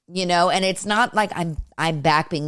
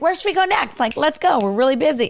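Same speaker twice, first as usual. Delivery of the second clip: high-pitched, playful eagerness; lively mock-eager chatter; eager acted quote; 8 semitones higher, 3 dB louder, faster than usual. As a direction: chirp it higher, ready to head out. Acting out their easygoing chatter.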